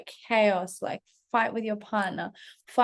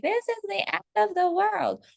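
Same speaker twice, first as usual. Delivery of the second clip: high-pitched melodramatic despair; mock catastrophizing; 10 semitones higher than usual. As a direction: wail it like a hammed-up drama queen. Voices a spiralling catastrophic thought.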